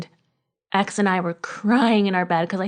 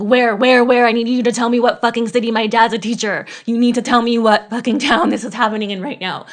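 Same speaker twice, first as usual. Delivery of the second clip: loud, higher, panicked pressing for an answer; retold panicked shout at a coworker; frantic demand; 4 semitones higher, 6 dB louder than usual. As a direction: relive the desperate plea to know the town. Frantic demand after hearing of shooting.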